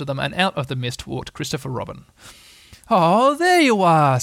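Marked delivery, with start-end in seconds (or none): 2.9-4.2 loud, drawn-out, casual greeting; long high "Oh", pretending no worry; careless. A narrator's reading. Christopher Robin hiding his worry, careless and drawn out. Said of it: "said Christopher Robin carelessly".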